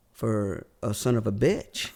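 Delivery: ordinary talk, nothing marked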